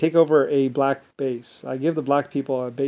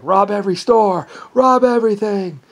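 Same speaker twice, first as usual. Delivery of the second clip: high, louder gang-boss command; rally the gang to loot; boss giving orders; 7 semitones higher, 6 dB louder than usual. Retold or imitated. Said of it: "Pops was like".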